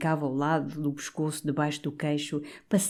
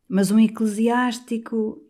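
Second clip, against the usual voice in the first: insinuating; 7 semitones higher, 4 dB louder, slower than usual. A narrator's reading. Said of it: "insinuou o coadjutor".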